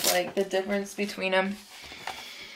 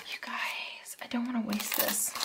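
awed whisper; 3 semitones higher, 13 dB quieter than usual. Real, not acted. Awed by pretty washi.

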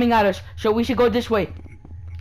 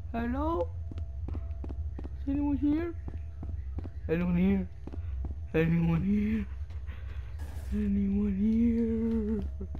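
hushed calling; 11 dB quieter, slower than usual. Real, not acted. Alone in dark game level.

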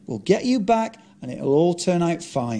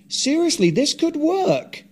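excited; 7 semitones higher than usual. Acted. Excited pitch.

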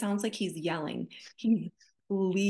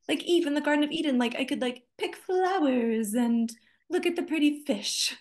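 naive Eve; 7 semitones higher than usual. Retold or imitated.

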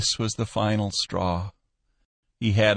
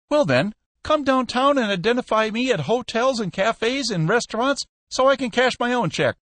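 much higher and louder, pushing hard; frantic Peck; 3 dB louder than usual. A narrator's reading. Peck pressures Skinner to open the safe, frantic and loud. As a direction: insist in rising desperation.

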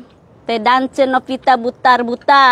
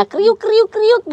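crunching chips; 9 semitones higher, much slower than usual. Retold or imitated. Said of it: "Musik, musik spesial mamahnya".

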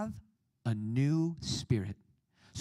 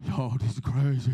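loud tough-guy imitation; gangbanger; 3 semitones lower, 6 dB louder than usual. Gangbangers reacting to the altar call, loud mock tough voice. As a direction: put on a loud gangbanger voice, awed. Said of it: "all the gangbangers are like".

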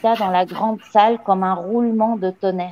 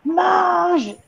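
high, drawn-out yell; howled order; 8 semitones higher than usual. A narrator's reading. Le Gourdin ordering the gamin to eat, howling. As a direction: howl the word at the child. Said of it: "hurla-t-elle".

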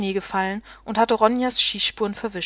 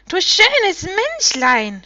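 high, loud, drawn-out harpy screech; piercing shriek; 9 semitones higher, 7 dB louder, much slower than usual. A narrator's reading. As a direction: shriek it mockingly as a harpy swooping on prey. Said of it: "schrie sie gellend".

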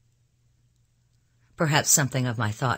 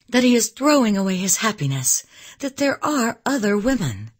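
high, louder, emphatic avowal; impassioned declaration; 8 semitones higher, 5 dB louder than usual. A narrator's reading. Elizabeth telling Sophie what she'll say, impassioned. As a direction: declare it ardently, pitched well up.

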